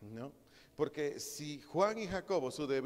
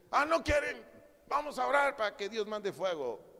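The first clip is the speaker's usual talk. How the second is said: loud, high mock outburst; explosive outburst; 8 semitones higher, 7 dB louder than usual. Hot-tempered disciples call for fire from heaven, acted explosive outburst. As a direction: blow up instantly as a hot-headed disciple. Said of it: "esos explotaban de volada".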